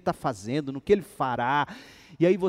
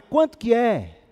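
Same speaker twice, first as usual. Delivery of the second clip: higher, louder demanding question; pushy question; 8 semitones higher, 8 dB louder than usual. Retold or imitated. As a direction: press him for the price. Demands the price.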